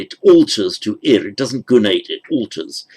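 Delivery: normal talking voice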